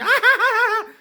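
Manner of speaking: high, loud uncontrolled laugh; nervous cackle. Acted. Subway man scolded by attackers, high nervous cackle. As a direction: burst out laughing, helplessly. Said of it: "he laughs when he's nervous".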